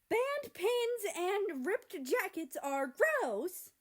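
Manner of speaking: very loud, high, slow yell. A narrator's reading. Australia yelling at New Zealand from behind France, loud. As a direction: belt it across the room. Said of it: "Australia yelled at New Zealand".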